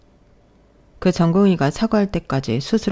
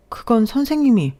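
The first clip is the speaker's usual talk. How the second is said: indignant protest; 7 semitones higher, 3 dB louder than usual. Acted. Nurse protests the false blame.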